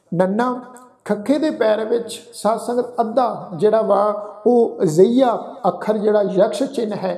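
normal talking voice

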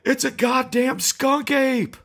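high, loud alarmed yell; terrified shout at the beast; panicked shout. Acted. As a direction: scream it in horror. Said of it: "he shouted".

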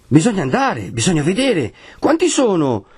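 high-pitched overlapping exclamations; tumultuous crowd debate. A narrator's reading. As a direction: call out like an agitated crowd. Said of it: "comincia una consulta tumultuosa". (consulta tumultuosa, grida acute)

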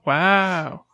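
drawn-out clown-style wow; the main wow. Retold or imitated. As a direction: imitate the clown's signature wow. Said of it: "that's kind of the main one".

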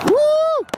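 high loud woo; whoop. Real, not acted. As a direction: whoop at the fireworks. Celebrating fireworks.

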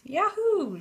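high whooping yahoo; excited high whoop; joyful yell. A narrator's reading. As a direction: shout with glee as you jump. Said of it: "she yelled".

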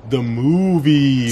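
punchline delivery. Acted.

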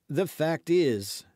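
high, slow, tentative opening; timid. A narrator's reading. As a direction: venture it hesitantly. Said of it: "hazarded D'Artagnan timidly".

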